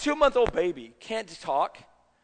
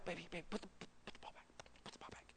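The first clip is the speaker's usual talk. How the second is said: put-on urgent whisper to a child; barely audible, trying not to be overheard; mock embarrassed parent's whispered order; hushed parental pleading; 26 dB quieter, much faster than usual. Parent discreetly pleading with child in public.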